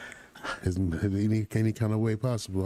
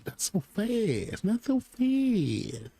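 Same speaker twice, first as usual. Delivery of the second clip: each repeat higher and slower, drawn out; mock stalling, rising higher and slower; playful not so fast; 9 semitones higher, much slower than usual. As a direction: teasingly stretch it out, climbing higher each time. Stopping the TV report playfully.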